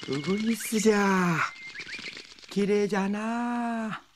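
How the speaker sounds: lightly voiced old man, wonder; a hint of an amazed old man. Man sees the nightingale.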